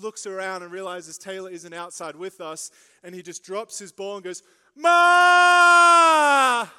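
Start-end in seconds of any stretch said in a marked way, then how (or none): 4.7-6.7 very loud, high-pitched drawn-out holler; one long piercing yell for mum; toddler calling mum. Retold or imitated. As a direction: imitate a small child bawling for his mother. Toddler son cries out for mum.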